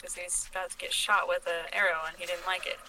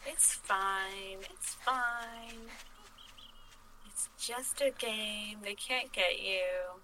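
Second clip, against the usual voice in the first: reassuring; 5 dB quieter, much slower than usual. Real, not acted. Calming the scared friend.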